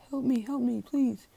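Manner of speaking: very high squeaky begging; a small high voice asking for rescue; high-pitched plea. Retold or imitated. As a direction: pitch way up and plead for help. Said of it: "and he's like".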